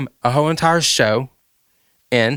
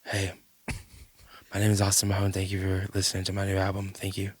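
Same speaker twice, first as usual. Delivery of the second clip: soft, low, subdued impression; 3 semitones lower, 10 dB quieter than usual. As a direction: imitate a singer murmuring a quiet album plug. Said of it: "I couldn't imitate you if I wanted to".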